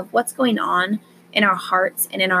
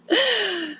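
high giggle; 11 semitones higher than usual. Acted. Laugh after mock surprise.

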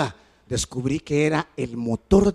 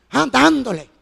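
normal talking voice

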